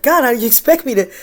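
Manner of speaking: high, loud incredulous protest to God; mock despairing plea to God; pleading with God. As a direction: throw up your hands, there's no way. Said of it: "I'm like".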